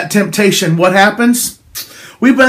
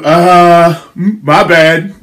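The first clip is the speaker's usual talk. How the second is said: sheepish serpent; 3 semitones lower, 4 dB louder, much slower than usual. Acted. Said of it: "What's the serpent do?".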